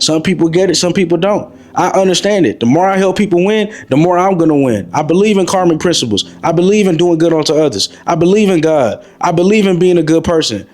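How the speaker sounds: ordinary delivery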